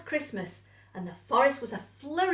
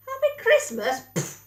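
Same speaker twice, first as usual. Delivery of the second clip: much higher, much louder scornful shout; grumpy shout; 13 semitones higher, 8 dB louder than usual. A narrator's reading. Badger shouting back at Squirrel from the window, scornful. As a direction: scoff it back indignantly. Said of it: "he shouted back".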